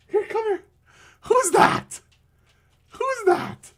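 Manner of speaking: squeaky high-pitched voice for the dog; high pet voice. Real, not acted. Owner coaxing his dog Bachi onto stream, squeaky high baby talk. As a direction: coo at the dog in a sing-song high voice.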